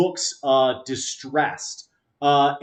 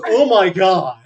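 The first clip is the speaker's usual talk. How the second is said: loud, higher, horrified exclamation; acted shock; 4 semitones higher, 7 dB louder than usual. Game master voices man looking at his hand, loud shock. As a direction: blurt it out in comic horror.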